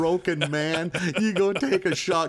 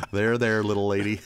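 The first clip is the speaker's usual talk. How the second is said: lower, patronizing there there; mock comforting, much lower; 6 semitones lower than usual. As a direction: pat her on the back with your voice. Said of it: "you're comforting her".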